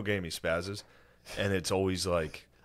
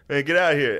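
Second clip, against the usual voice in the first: louder, higher imitated brush-off; mock gruffness-free angry dismissal; annoyed defensive end; 7 semitones higher, 8 dB louder than usual. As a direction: act out an irritated player shooing someone.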